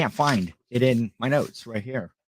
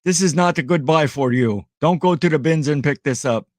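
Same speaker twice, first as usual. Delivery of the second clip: higher-pitched mock Russian accent; Roman's Russian accent; 4 semitones higher, 3 dB louder than usual. Reseller imitating a friend warning viewers off bad buys, mock Russian accent. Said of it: "that's my, my Roman Russian".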